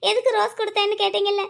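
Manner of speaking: normal talking voice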